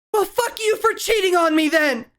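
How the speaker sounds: high yelling woman, furious at cheating partner; angry woman. Acted. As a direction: shriek it like a betrayed woman. GM voices angry woman shouting.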